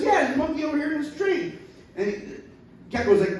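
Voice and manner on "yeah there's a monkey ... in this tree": higher laughing cartoon voice; gecko voice. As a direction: point the way, amused. Gecko answers gator.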